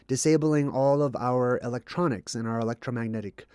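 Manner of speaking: normal talking voice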